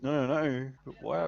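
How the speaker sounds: usual speaking voice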